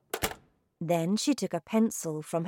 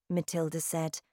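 ordinary delivery, nothing marked

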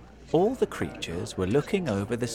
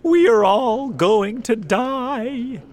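high, loud, drawn-out wail; slow, high shout of panic; panicked cry; 12 semitones higher, 9 dB louder, slower than usual. A narrator's reading. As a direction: cry out in doomed terror. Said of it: "the officers and firefighters cried".

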